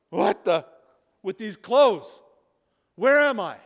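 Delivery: loud, startled and bewildered; confused Lazarus. Pretend. Lazarus waking in the tomb, loud and confused. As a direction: wake up bashing your head, baffled.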